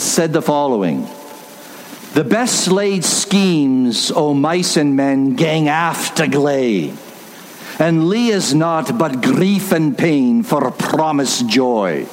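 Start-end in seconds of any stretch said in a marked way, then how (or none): 2.1-12.1 slow, deliberate Scottish recitation; attempted Scottish accent. Preacher quoting a Burns poem to congregation, Scottish accent. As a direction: recite it in broad Scots dialect. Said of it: "my attempt at a Scottish accent".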